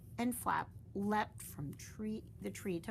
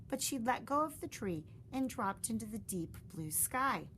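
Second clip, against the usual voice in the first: ordinary delivery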